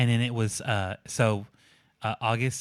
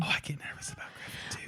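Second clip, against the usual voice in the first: hushed half-whisper; 4 semitones higher, 10 dB quieter, faster than usual. Real, not acted. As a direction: whisper it quickly, secretive. Whispered aside about son.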